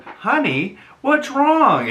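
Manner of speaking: high, sweet mock American honey; mock American accent. Mocking [name] dropping the accent, sugary high American. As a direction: drop the Italian, go sugary American. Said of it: "all of a sudden she's like".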